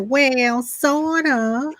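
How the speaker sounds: high, drawn-out Southern cartoon voice; Southern accent. Podcaster retelling the animated clock's coy answer, Southern accent. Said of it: "With a Southern accent".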